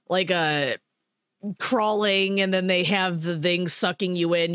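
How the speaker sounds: ordinary talk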